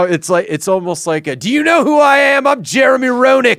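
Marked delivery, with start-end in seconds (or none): 1.3-3.6 high, loud entitled yell; petulant entitled shout. Retold or imitated. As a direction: demand it like a spoiled star. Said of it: "he's very petulant".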